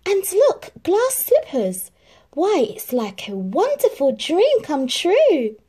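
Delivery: put-on high, loud, thrilled young girl; Cinderella, delighted. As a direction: gush with wonder at the slippers. Cinderella delighted by her dress.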